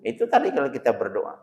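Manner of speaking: ordinary delivery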